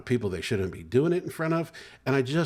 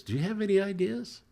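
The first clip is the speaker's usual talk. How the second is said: a touch higher, light imitation of the wife asking for ideas; lightly voiced wife; 10 semitones higher than usual. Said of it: "My wife could say".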